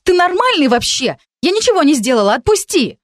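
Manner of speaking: high, loud indignant yelling; angry protest. A narrator's reading. Girl being dragged, yelling at her captor to let go. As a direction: shout at him to let go.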